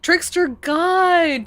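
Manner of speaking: high, drawn-out hail to a god; mock-grand exclamation. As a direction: stretch it out high in playful awe. Repeats the title, hamming it up.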